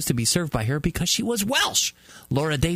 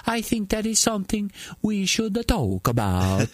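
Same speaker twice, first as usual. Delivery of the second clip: mock Dutch accent; 7 semitones higher than usual. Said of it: "Reuters quotes Anna Marie Jorritsma".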